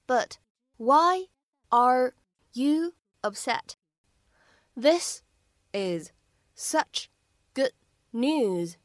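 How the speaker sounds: very slow, a pause between words; each word separated and fully pronounced. Shown as a demonstration. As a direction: read it word by word for learners. Same dialogue, word by word.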